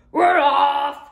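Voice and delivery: crew shout. Acted. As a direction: shout it out all together. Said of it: "we all shouted".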